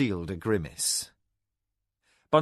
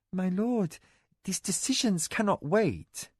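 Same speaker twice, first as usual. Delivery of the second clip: wife's measured plea; 8 semitones higher than usual. Acted. Mara pleads with her lord over the estate. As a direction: keep it composed and deferential.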